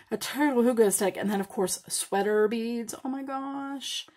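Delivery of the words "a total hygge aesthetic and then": ordinary delivery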